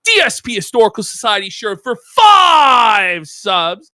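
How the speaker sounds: loud donation shout-out with a stretched FIVE; belted-out sub shout-out; hype announcement. Pretend.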